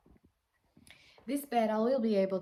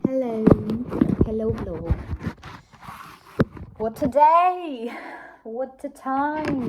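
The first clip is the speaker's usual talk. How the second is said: theatrical sigh; 3 semitones higher, 12 dB louder than usual. Acted. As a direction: ham up a weary day. Dramatic sighing greeting.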